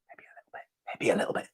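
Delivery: whispered, guilty confession; hushed, playfully guilty; sheepish whisper. Acted. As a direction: mutter it under your breath like a naughty admission. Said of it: "I didn't say that".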